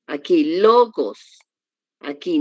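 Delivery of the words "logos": "logos" slowly, long stressed LO; stress on first syllable. Shown as a demonstration. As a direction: swell on LOO-gos, then fall. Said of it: "dónde está el acento para hacer la fuerza".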